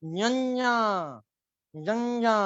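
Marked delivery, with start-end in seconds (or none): 0.0-0.7 न्यन्या pitched higher with nasal ny; nasal syllable. Shown as a demonstration. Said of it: "तो कैसे pronounce करना है?".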